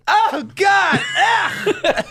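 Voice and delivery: high, loud, horrified shriek through laughter; laughing mock scream of disgust; shocked shriek. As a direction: shriek like a dog owner who just saw the hair. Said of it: "she looks at her dog and she goes".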